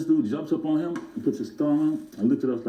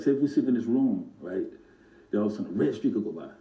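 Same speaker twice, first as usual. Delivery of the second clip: normal talking voice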